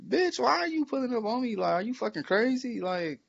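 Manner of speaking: hammed-up high-pitched fury; mock outraged yell. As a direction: shriek it back at her in disbelief. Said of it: "And I'm like".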